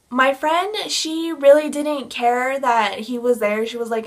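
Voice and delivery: normal talking voice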